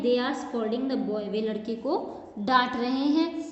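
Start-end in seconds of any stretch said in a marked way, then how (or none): none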